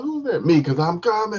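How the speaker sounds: hammed-up loud squeal; high loud punchline. Knock-knock punchline at climax, loud high squeal. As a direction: belt the joke high. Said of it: "She's like".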